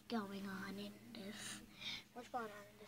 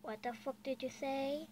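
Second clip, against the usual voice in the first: ordinary delivery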